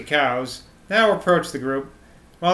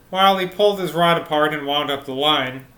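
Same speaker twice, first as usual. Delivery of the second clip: ordinary delivery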